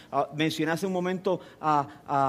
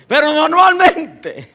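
laughing; 11 semitones higher, 12 dB louder, slower than usual. Real, not acted. Laughing after Burger King joke.

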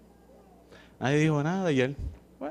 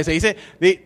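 usual speaking voice

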